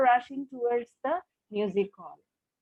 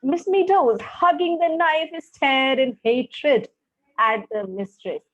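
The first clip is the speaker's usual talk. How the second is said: dramatic story reading; 3 semitones higher, 5 dB louder than usual. A narrator's reading. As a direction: read it with heavy drama. Teacher reads the story aloud.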